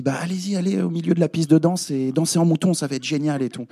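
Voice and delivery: voix aiguë et excitée de réalisateur; réalisateur enthousiaste. Retold or imitated. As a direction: imite le réalisateur qui pousse à danser. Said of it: "le réalisateur nous dit". (réalisateur tout excité)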